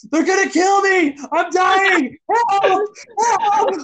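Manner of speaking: high, loud fake cries for help; mock panic screaming. Pranking scammers on the phone, hammed-up fake panic. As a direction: scream like you're being murdered, hammed-up. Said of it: "I'm yelling profanities like".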